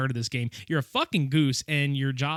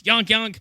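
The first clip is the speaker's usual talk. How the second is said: higher, louder silly honking; goofy goose honk; 8 semitones higher, 5 dB louder than usual. Pretend. Joking about the goose game, honking goofily.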